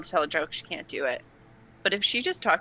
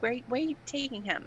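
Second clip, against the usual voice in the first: put-on mom's Long Island accent, softer and higher; 6 semitones higher, 6 dB quieter than usual. Daughter imitates her mom asking about the visiting friend, Long Island accent. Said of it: "Long Island accent".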